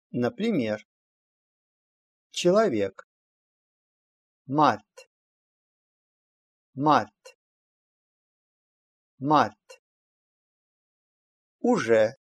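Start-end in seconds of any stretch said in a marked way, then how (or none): 4.5-9.8 "Март" with final d sounded as t; teacher's careful "Март", ending on t; demonstrated pronunciation. Shown as a demonstration. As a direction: model "Март", releasing a crisp t after r. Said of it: "произносится «т»".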